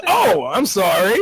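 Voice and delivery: slow, high, loud fake-sorry; hammed-up insincere apology; mock apology. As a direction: draw out a sarcastic sorry. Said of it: "He goes".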